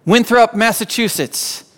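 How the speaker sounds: exhausted player yelling back at the coach; yelled out. Retold or imitated. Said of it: "he yells out".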